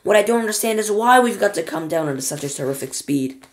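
high, loud nervous protest; yelling over noise. A narrator's reading. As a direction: project it over the roaring wind. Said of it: "he yelled above the noise".

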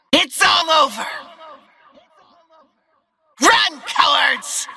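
high, full-force combat shouts; battle cries. Acted. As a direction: shout threats at fleeing enemies. Character shouting attacks at enemies.

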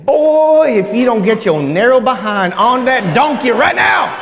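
scolding mother. Retold or imitated. Preacher imitating Mary yelling at runaway Jesus, mock shout. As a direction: yell at your kid like a fed-up mom. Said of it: "I can see Mary saying".

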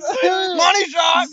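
slow, stretched-out shout; drawn-out shout. Real, not acted. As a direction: drag the words out and yell them. Friends chanting during the dare.

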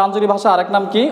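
normal talking voice

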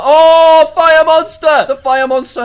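high, loud panicked cry, fading down; panicked shriek. Real, not acted. Gamer shrieking as a fire monster finds him.

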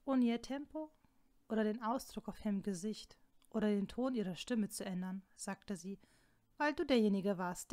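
usual speaking voice

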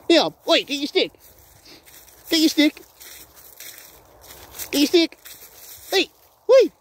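high, eager calls to the dog; excited dog call. Real, not acted. Owner urging his dog to fetch, high excited call. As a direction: hype the dog up to grab the stick.

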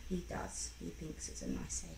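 hushed. Acted. Mother lowers voice.

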